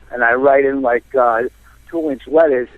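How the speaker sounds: ordinary delivery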